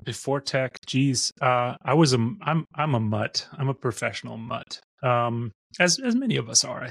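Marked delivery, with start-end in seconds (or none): none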